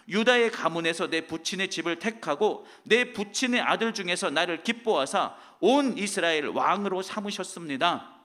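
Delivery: solemn public reading of scripture. Acted. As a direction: declaim the verse to the congregation. Reads scripture aloud.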